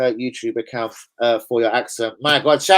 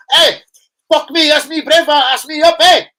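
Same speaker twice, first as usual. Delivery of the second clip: high, loud Scottish shout; Scottish player voice; 13 semitones higher, 10 dB louder than usual. Retold or imitated. Voicing Tierney fresh off the pitch, loud Scottish shout. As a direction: blurt it out like the excited wee man.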